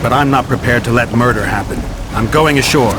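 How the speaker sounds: shouted refusal, heading ashore; firm shout. Acted. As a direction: raise your voice over the fighting. Geralt shouts refusal over battle.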